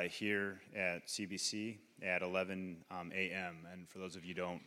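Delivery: ordinary talk, nothing marked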